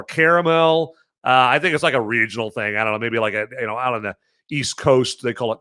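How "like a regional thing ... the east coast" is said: ordinary delivery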